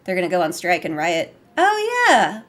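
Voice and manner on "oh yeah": sudden high burst of recognition; excited. Real, not acted. Remembering a game moment, excited squeal. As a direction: squeal it as it comes back.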